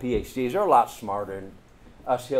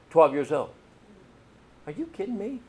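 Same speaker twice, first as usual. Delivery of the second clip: usual speaking voice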